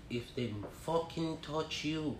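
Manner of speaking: slow, menacing whisper. Retold or imitated. Mother secretly advising her child, slow menacing whisper. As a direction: hush it right down, slowly. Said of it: "she'll whisper".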